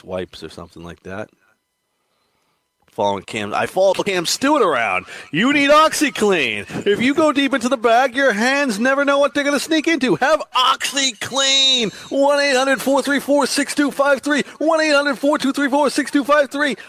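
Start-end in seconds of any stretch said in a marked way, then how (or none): 5.3-16.8 infomercial pitchman. Retold or imitated. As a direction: sell it like a late-night ad. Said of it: "You'd be a good infomercial guy".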